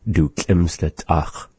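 slow demo of "Du schlimmste Tach"; language example. Shown as a demonstration. Teacher modelling "Du schlimmste Tach" for learners. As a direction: say "Du schlimmste Tach" slowly, sounding the ch.

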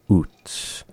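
whispered Nuchtluts, k only, no L; soft Nuchtluts with no added sound; no extra sound. Shown as a demonstration. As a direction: quote the elder softly, Nuchtluts. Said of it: "not adding any extra thing".

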